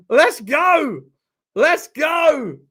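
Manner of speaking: loud, high victory yell; triumphant roar, falling at the end; celebratory shout. Real, not acted. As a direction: shout it out like a fan. Celebrating rival's late defeat.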